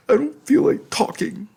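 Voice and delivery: tearful pout. Acted. Pouting through tears.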